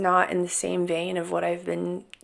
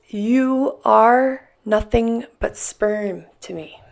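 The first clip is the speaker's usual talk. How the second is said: slow, deliberate reading; 2 semitones higher, 5 dB louder, much slower than usual. Retold or imitated. Reading a hurtful text.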